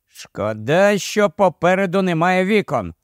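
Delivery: ordinary delivery, nothing marked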